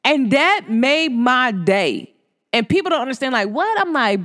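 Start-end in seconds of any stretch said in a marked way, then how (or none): none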